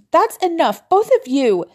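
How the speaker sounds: sharp high shout. A narrator's reading. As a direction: yell to stop the fight. Breaking up an argument.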